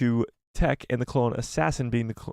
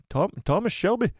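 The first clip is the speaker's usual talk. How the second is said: higher, tentative Irish accent; attempted Irish accent; 7 semitones higher than usual. Shown as a demonstration. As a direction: feel your way into an Irish accent. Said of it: "I'll do my Irish accent again".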